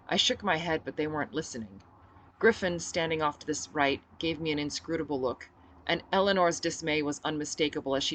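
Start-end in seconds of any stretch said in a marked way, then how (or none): none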